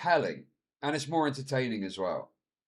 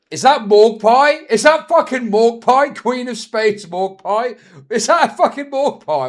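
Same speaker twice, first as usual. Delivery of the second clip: much higher, much louder disbelieving shout; shocked recognition; 9 semitones higher, 12 dB louder than usual. Real, not acted. Streamer spotting a familiar face on screen, loud astonished shout. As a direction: blurt it out as you suddenly recognise her.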